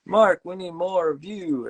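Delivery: high-pitched imitation of pleading fans; mock begging. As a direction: plead like an adoring, needy crowd. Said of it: "constantly begging".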